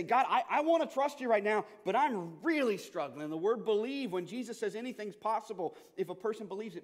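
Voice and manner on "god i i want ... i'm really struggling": high, loud, pleading prayer; anguished prayer. Pretend. Parent of a suffering child praying to God, loud and pleading. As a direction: cry out to God in struggle.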